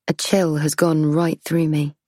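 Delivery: usual speaking voice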